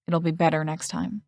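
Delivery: plain reading voice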